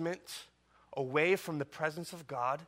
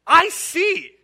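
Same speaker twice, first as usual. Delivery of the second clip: higher, louder, eager yes I see; joyful confession, pitched up; eager believer's answer; 11 semitones higher, 15 dB louder, much slower than usual. Pretend. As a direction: answer like a believer who suddenly sees. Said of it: "and you say".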